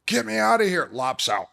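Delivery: high, loud cry to get out; panicked shout. Retold or imitated. Patient waking in the MRI tube, panicked shout. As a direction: burst out yelling in mock panic.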